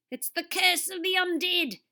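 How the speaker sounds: put-on high, loud dramatic decree, Australian accent; hoarse decree. A narrator's reading. TV star decrees his curse from the tree, hoarse. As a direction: proclaim doom in a hammed-up Aussie voice. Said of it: "Derek decreed hoarsely".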